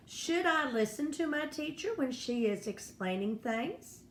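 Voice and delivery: "things" rises at the end, as a question; question tune. Shown as a demonstration. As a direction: ask it, lifting sharply on "things". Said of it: "That is a question word".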